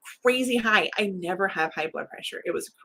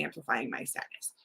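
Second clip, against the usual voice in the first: softer and lower, trailing off to a breathy whisper; fading to a whisper; 7 semitones lower, 10 dB quieter than usual. Real, not acted. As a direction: let amplifying my sadness die away into a whisper. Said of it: "And all of that came true".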